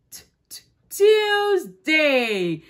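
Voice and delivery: stretched "t-, t-, Tuesday", slow and teasing; sounding out the t. Shown as a demonstration. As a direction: sound out the first letter, then land on "Tuesday". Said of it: "tomorrow will be".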